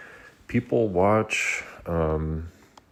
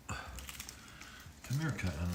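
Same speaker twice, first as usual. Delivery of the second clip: soft breathy whisper to a cat; whispered call; 14 dB quieter, much slower than usual. Real, not acted.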